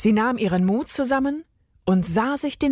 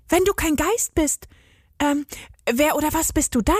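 put-on higher girl's voice, quick at the end; ask, curious and wary; 6 semitones higher than usual. A narrator's reading. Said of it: "wollte Agathe wissen". (neugierige hohe Mädchenstimme)

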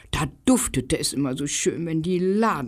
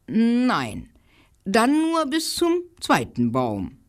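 squirrel; 6 semitones higher than usual. Acted. Squirrel objects.

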